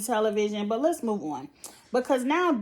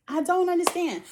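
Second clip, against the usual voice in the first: higher, louder I don't understand; exasperated; 8 semitones higher, 3 dB louder than usual. Real, not acted. Vlogger venting about Josh, exasperated and high. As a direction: throw up your hands in disbelief. Repeats her confusion, exasperated.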